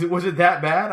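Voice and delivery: high, loud imitated wife question; wife asking. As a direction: ask it high, mimicking the wife. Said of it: "And she goes".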